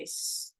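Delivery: soft sustained s hiss; snake hiss. Shown as a demonstration. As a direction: hiss s gently. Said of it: "the sound s like a snake".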